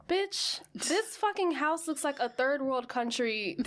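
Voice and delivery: sassy, incredulous telling-off; indignant clapback. Acted. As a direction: clap back at them in mock outrage. Acted retort to ignorant Americans.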